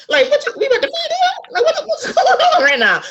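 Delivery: high, laughing what-is-happening; amused incredulous squeal; laughing disbelief. Retold or imitated. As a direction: laugh through your disbelief.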